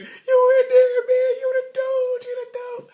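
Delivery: high, loud, hooting friends; hammed-up playground cheering, slowed and stretched; excited hype. Friends cheering him after Shonda talks to him, loud and high.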